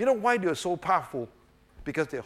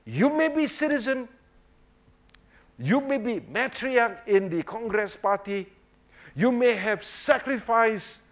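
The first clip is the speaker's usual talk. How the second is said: preaching; 8 semitones higher, 4 dB louder than usual. Real, not acted. Preacher listing all she may be, declaiming high. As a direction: declaim each concession, pitched well up.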